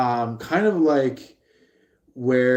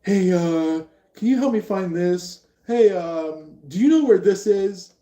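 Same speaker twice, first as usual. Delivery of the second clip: higher, louder nagging voice of daily life; pestering interruption; 8 semitones higher, 5 dB louder than usual. Pretend. Everyday life interrupting, higher and louder. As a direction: pipe up higher and louder, asking for help. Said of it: "everyday life shows up and is like".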